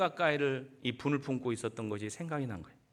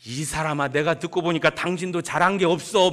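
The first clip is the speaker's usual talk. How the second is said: louder, reproachful husband; scolding husband; 3 semitones higher, 7 dB louder than usual. Retold or imitated. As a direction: lay the blame on her. (아내를 나무라는 남편 흉내, 큰 소리로)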